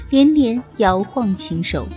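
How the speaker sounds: usual speaking voice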